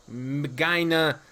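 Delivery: "Magina" slowly as muh-GUY-nuh, hard g; trying out pronunciation. Shown as a demonstration. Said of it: "forgot what the proper pronunciation was".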